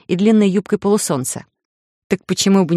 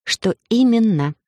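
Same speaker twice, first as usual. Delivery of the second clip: slow, higher, insistent question; needling repetition; 5 semitones higher, slower than usual. A narrator's reading. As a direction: ask again slowly to needle him. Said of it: "решив немного повредничать". (вредничая, медленно переспрашивая)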